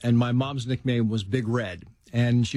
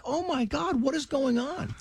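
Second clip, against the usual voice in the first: put-on much higher, disbelieving wail of a confused eight-year-old; bewildered kid; 13 semitones higher than usual. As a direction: throw the voice up high in mock dismay. Boy bewildered at football practice.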